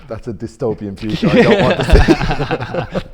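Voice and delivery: high laughing, slow. Real, not acted. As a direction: laugh it out in disbelief. Laughing at rat warehouse.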